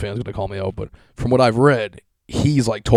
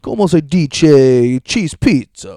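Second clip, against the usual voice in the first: very slow, louder fake Spanish accent; mock Spanish; 4 semitones higher, 8 dB louder, much slower than usual. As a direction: ham it up like an obnoxious tourist. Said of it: "to be, like, a jerk".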